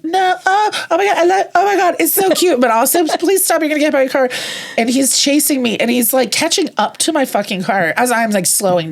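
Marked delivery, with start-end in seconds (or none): 0.0-4.3 high, squealing excitement over a cute dog; excited squeal. Retold or imitated. Driver sees cute dog chasing her car, squealing high. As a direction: gush in a much higher voice, then plead.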